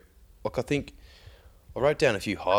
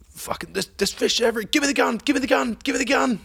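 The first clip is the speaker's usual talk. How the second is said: much higher and louder, gabbling for the gun; frantic excitement; 5 dB louder, faster than usual. Retold or imitated. Mate underwater yelling for the spear gun, frantic and high. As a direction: mimic a mate losing it over the fish. Kev sees fish everywhere.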